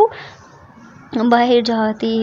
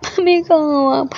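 high, tearful whimpering; crying child; 4 semitones higher than usual. A narrator's reading. Crying girl begging for her mother, tearful. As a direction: plead through tears for mummy. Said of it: "और ज़्यादा रोने लग जाते".

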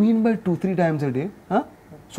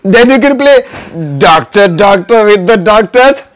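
excited teasing; 8 dB louder than usual. Real, not acted. Loud playful tease.

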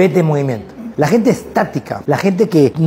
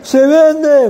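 high, loud "Se vende" exclaimed; loud excited announcement; 10 semitones higher, 4 dB louder than usual. Retold or imitated. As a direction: spot the sign and call it out. Student reads sign excitedly.

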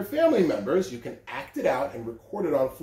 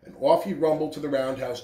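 usual speaking voice

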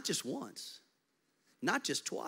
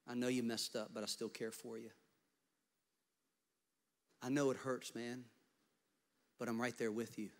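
softer comforting words; lightly voiced Jesus consoling Peter; a hint of tender Jesus; 6 dB quieter than usual. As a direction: hold him up gently.

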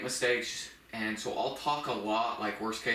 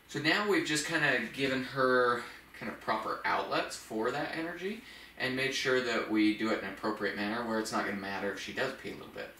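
ordinary talk, nothing marked

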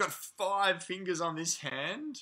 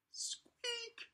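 tiny squeak; 10 dB quieter than usual. Acted. Book word squeak performed.

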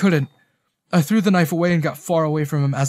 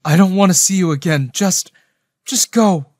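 high, loud, hurt dismissal; voice breaking; 5 semitones higher, 8 dB louder than usual. A narrator's reading. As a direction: send him away, close to breaking. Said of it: "my voice breaking".

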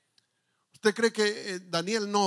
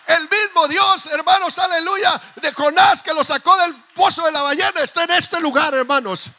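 loud, higher proclaiming; full-force preaching; 6 semitones higher, 7 dB louder than usual. Acted. Pastor declaring to the congregation that God is here. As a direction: proclaim it over the whole church.

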